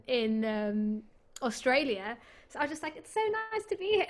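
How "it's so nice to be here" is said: fake bright cheer. Hiding secret at press night.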